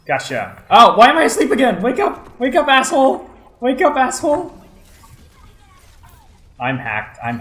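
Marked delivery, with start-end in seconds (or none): 0.7-4.6 high, loud exasperated yelling; frustrated yelling. Real, not acted. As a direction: yell wake up at yourself. Yelling at own sleeping character.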